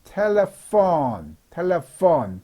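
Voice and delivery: "telephone" drawn out slowly, PH as F. Shown as a demonstration. Teacher models a word for learners, slow "telephone" with PH as F. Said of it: "F 발음이라고 생각하시면 돼요".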